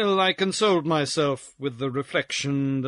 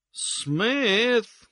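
long, stretched-out drawl; slow, languid drawler; drawled syllables; 9 semitones higher than usual. Acted. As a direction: drag the single syllable right out. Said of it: "drawling out his syllables".

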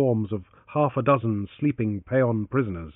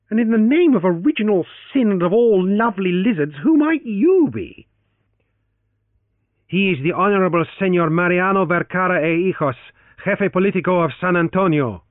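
raised, loud character dialogue; 8 semitones higher, 6 dB louder than usual. Acted. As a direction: lift the voice high and loud for the quoted speech. Francis and Torres in dialogue.

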